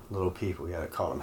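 trailing off. Real, not acted. S2's remark fades at its end.

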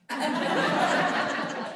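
a burst of voiceless laughter; real airless laugh; silent laugh. Real, not acted. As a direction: laugh at your own joke. Speaker laughs at own story.

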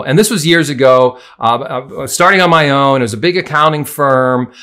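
ordinary talk, nothing marked

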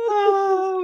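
high, loud hammed-up cry; mock dramatic wail. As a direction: wail it like daytime TV.

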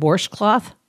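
"washcloth" with an R, as WARSH-cloth; warsh pronunciation. Shown as a demonstration. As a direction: put an R before the SH in "washcloth". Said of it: "I didn't even know I said it that way".

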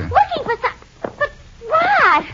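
high, slowed and near tears; rising close to crying; distressed outburst. Acted. Distressed at the wrecked room.

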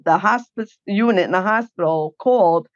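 usual speaking voice

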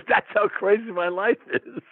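said through a high laugh. Real, not acted. Summing up his crazy life to the host, laughing. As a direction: laugh it out, amused at your own life.

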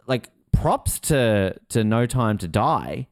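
ordinary talk, nothing marked

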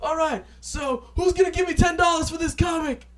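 high, loud salesman shout; hype man shout. Retold or imitated. [name] asking the crowd for ten dollars, loud hype shout. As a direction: sell it to the crowd. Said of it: "he's just like".

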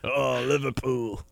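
slow, drawn-out British fan; mock British cheer. Friend repeating British guy's football cheer, drawn-out accent. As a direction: savour it in cartoon British.

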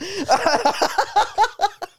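high cackle; high squeal laugh. Real, not acted. Laughs after rant.

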